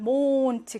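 몬트 with a drawn-out o; slow, held vowel in 몬트; long vowel exception. Shown as a demonstration. Teacher showing an exception, long o in 몬트. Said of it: "길게".

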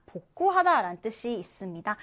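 normal talking voice

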